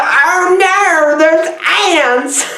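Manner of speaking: high, hammed-up throaty cry. Police officer discovering ants, throaty mock alarm. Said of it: "I love talking in my throat today".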